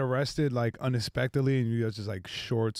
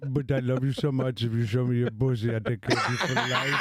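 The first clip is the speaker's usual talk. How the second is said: put-on Indian accent, laughing, pleading; wooing in an Indian accent. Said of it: "Indian gas station wedding proposals".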